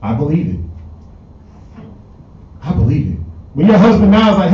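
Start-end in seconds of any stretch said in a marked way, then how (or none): 0.0-3.2 hushed believing. Retold or imitated. Wife voiced believing broke boyfriend.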